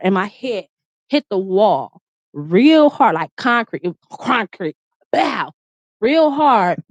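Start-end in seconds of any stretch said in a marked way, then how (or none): none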